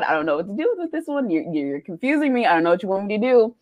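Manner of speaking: usual speaking voice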